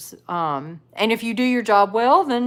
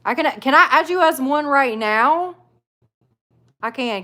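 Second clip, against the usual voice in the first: ordinary talk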